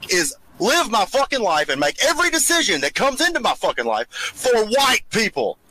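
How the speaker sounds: normal talking voice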